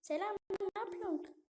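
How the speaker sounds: put-on lightly high girly voice; a hint of a girl's voice. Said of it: "Kız sesi yapacağım". (hafif kız sesi)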